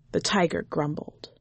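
ordinary delivery, nothing marked

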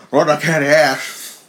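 slow Texan wrestler drawl; Austin impression. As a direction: stretch it out like Stone Cold. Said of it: "I always go for Austin when I try to do candy ass".

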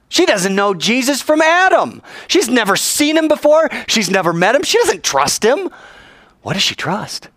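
high, loud preacher's fervour; fervent preaching. Real, not acted. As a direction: drive the point home to the congregation. Preaching at full force.

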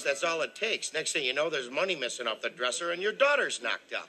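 ordinary talk, nothing marked

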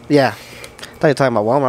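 normal talking voice

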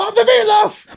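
put-on high basement-kid yell; yelling across the room. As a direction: shriek for mom from the basement.

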